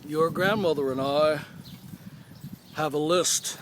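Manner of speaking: a hint of a deep-voiced grandpa; deep-voiced grandfather. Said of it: "he had this real deep voice".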